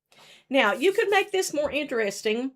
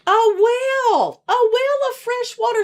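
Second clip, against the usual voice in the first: excited reveal; 11 semitones higher, 8 dB louder than usual. Acted. Storyteller reveals the well.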